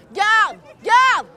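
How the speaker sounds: loud urgent call; calling the guard. Acted. The king calls his guard in a panic, loud.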